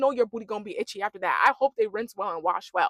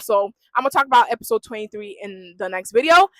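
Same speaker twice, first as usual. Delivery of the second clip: ordinary delivery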